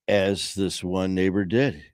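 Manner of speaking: ordinary talk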